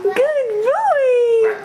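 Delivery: delighted, squealing praise for a little one; excited praise. Real, not acted. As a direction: sing out proud praise, high and long. Praising a toddler's animal sound.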